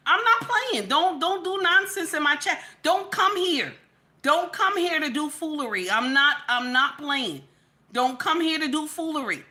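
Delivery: high, forceful warning; angry scolding. Real, not acted. Host warning the chat against foolery, angry and forceful. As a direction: lay down the law, pitch pushed up.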